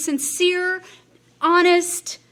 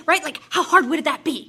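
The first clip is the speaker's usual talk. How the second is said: ordinary talk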